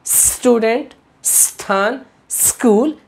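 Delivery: "स्टूडेंट, स्थान, स्कूल" each opening on a drawn-out s; no initial vowel. Shown as a demonstration. Teacher recapping correct speech, long s starting "स्टूडेंट, स्थान, स्कूल". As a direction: hold the hiss at the start of "स्टूडेंट", "स्थान", "स्कूल", pausing between. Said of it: "इसका उच्चारण".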